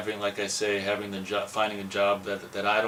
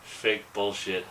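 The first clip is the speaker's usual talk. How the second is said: ordinary delivery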